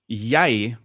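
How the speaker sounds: "Jeg" said "yai"; Oslo pronunciation. Shown as a demonstration. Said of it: "become, becomes "a".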